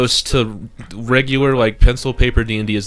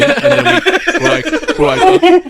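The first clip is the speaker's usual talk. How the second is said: breaking into high laughter mid-sentence; words squeezed through laughter; laughing; 6 dB louder, slower than usual. Real, not acted. Laughing while pitching cringe panel.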